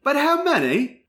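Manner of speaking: put-on high-pitched British-accented Skylar; Minnie Driver accent. Skylar quizzing Will on his family, in a British accent. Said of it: "do it in the Minnie Driver accent".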